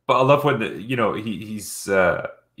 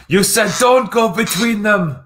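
Indy quote; 13 semitones higher, 5 dB louder than usual. Retold or imitated. Quoting Indy's line to Elsa.

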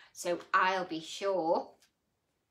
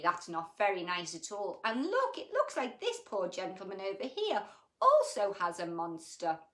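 ordinary delivery, nothing marked

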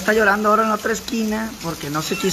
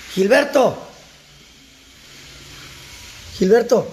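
calling the cat Gilberto, loudly; 4 semitones higher, 4 dB louder than usual. Real, not acted. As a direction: call the name loud and high across the house.

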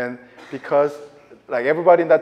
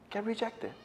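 quiet echo; 7 semitones higher, 13 dB quieter than usual. Shown as a demonstration. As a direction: let "Get rejected." fall away softly.

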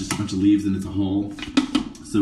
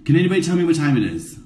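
impatient demand; 6 semitones higher, 5 dB louder, faster than usual. Real, not acted. Demanding time from crew.

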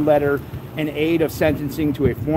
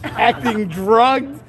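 laughing while reading; 6 semitones higher, slower than usual. Real, not acted.

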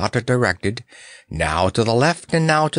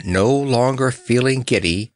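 plain reading voice

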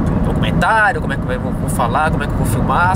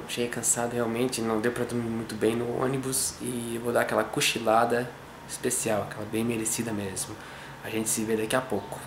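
hushed, much softer voice so nobody wakes; speaking softly; 3 semitones lower, 11 dB quieter than usual. Real, not acted. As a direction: keep it low, someone's sleeping next door. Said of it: "Fala baixo que ele foi dormir".

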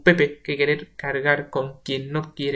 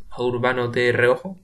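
plain reading voice

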